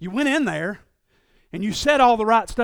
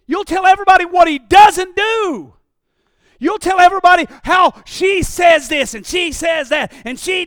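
loud, rising preacher's cry, lines hammered out; full-force preaching; 5 semitones higher, 8 dB louder than usual. Real, not acted. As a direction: preach it hard to the congregation.

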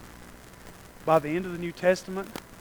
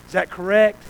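ordinary delivery

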